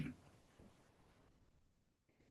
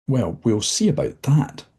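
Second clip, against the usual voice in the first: higher, louder sharp retort; sharp reply; 7 semitones higher, 5 dB louder than usual. Acted. Dozzie answers the visitor sharply, defiant. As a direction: snap back in defiance. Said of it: "replies Dozzie sharply".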